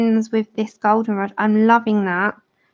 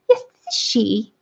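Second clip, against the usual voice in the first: posh phone voice. Retold or imitated. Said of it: "I have a phone voice too".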